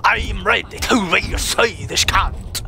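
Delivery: hushed mock whisper; breathy whispered mockery. Mocking game voice line.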